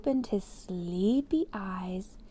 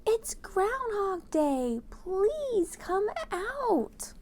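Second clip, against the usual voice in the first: high, very slow pleading call; mock crowd shout; 11 semitones higher, much slower than usual. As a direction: coax him out, slow and high. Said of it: "The people added with a shout".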